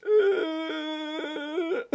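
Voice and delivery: high strained caveman groan; caveman grunt. Retold or imitated. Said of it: "Why did I sound like a caveman?".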